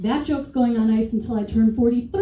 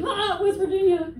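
mock groaning crowd; 10 semitones higher than usual. The crowd groaning at her West Virginia joke, high. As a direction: groan it like a disgusted audience. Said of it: "they're like".

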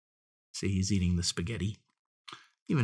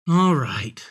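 sighing; 5 semitones higher, 8 dB louder than usual. A narrator's reading. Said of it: "sighed the piglet".

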